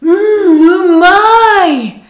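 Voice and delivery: umai roared very loud and slow like a satisfied man; manly umai. Shown as a demonstration. A man sweating over ramen, loud gruff satisfaction. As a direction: slurp ramen and bellow umai. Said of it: "un homme qui ... manger vos ramen".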